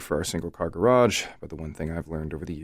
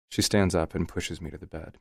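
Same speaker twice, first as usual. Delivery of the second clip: plain reading voice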